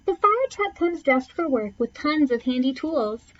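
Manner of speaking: ordinary delivery, nothing marked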